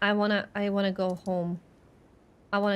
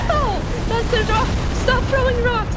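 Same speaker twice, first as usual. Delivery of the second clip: high, loud protest; alarmed yelling; 11 semitones higher, 8 dB louder than usual. Real, not acted. Player yelling at an enemy throwing rocks, alarmed. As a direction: yell out high as if being pelted.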